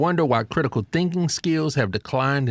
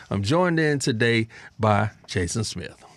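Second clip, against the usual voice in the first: usual speaking voice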